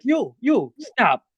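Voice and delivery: high stammering command; guard ordering stop. Acted. Guard pointing at Charlie, high stammered order. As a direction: point and bark at the intruder. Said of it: "and he's like".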